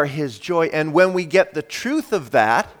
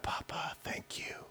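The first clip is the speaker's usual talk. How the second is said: barely audible, grateful whisper; whispered thanks; 22 dB quieter than usual. Acted. Preacher thanking God as Papa, grateful whisper. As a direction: whisper it as an intimate prayer.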